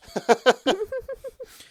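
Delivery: high loud burst of delighted laughter; a real gleeful cackle. Real, not acted. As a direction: cackle with glee at what's coming. Gleeful laugh before a story.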